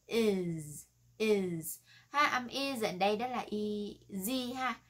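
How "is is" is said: "is" ending on a held, buzzing z; voiced Z ending. Shown as a demonstration. Teacher drilling the final sound, "is" with Z. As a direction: say "is" as "izz". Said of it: "Kết thúc bằng âm Z".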